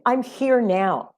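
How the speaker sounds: usual speaking voice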